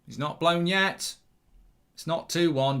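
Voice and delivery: ordinary delivery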